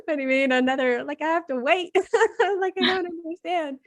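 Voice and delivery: high-pitched, laughing disbelief; laughing bafflement. Retold or imitated. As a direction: retell your bewilderment through laughter, pitch climbing high. Retelling her baffled reaction while laughing.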